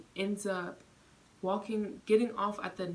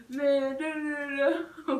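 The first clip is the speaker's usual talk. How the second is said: higher, louder mock holler; retold taunt; 7 semitones higher, 8 dB louder, slower than usual. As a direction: holler it while stepping off. Man leaving the train yelling back.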